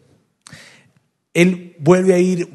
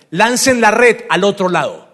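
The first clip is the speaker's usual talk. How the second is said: raised, louder call; shouted instruction; 5 semitones higher, 6 dB louder than usual. Acted. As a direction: call the order across the water. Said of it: "Y él les grita". (grito de instrucción desde lejos)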